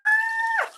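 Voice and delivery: very high, loud shriek of surprise; excited scream. Real, not acted. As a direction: scream out at an unexpected gift. Surprised by a viewer's gift.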